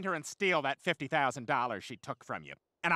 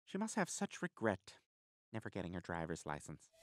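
wistful aside; 12 semitones lower, 11 dB quieter than usual. Acted. Mock-wistful aside after joke.

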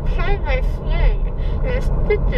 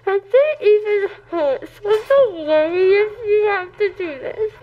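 real tearful laughter; crying and laughing together; laughing while crying; 4 semitones higher than usual. Real, not acted. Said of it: "She's sad".

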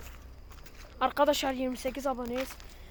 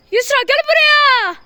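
shouting; 9 semitones higher, 9 dB louder than usual. Real, not acted. Calling out while scared.

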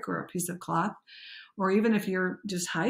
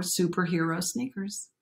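ordinary talk, nothing marked

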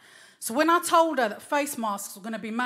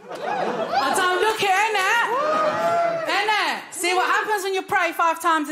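mock boast; 7 semitones higher, 3 dB louder than usual. Comic bragging about her age, high preening voice. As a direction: show off like a diva.

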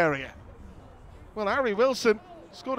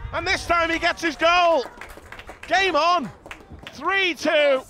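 excited goal call; 6 semitones higher, slower than usual. Real, not acted. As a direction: call the comeback goal with a rush. Goal called live.

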